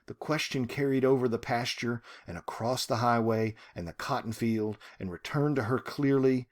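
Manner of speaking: normal talking voice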